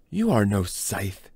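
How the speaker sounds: slow, daring contempt. Acted. Pinned scythe daring his captor, slow and contemptuous. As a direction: dare him with each slow word. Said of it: "Brahms dared to say".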